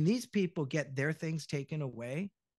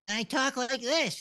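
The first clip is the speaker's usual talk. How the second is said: higher, slow cartoon character voice; Bot Bots character voice; 8 semitones higher, 4 dB louder, slower than usual. Shown as a demonstration. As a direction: draw it out in a high-pitched put-on voice. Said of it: "I was doing Bot Bots".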